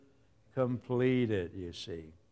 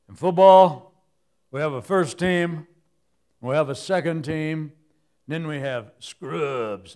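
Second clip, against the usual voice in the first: booming football guy; 7 dB louder than usual. Retold or imitated.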